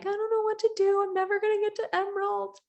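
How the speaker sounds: high, whiny mock despair. Recruiter whining to herself about never reaching Emerald. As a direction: whine it like you've given up hope. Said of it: "you're like".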